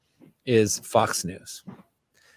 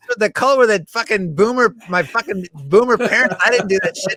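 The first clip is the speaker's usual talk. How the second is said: high, loud sneering impression; mocking guy voice; 9 semitones higher, 5 dB louder than usual. Scene: sending up his own stance to the host, high loud mock voice. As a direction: lampoon a smug pragmatist, pitched up. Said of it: "I'm like".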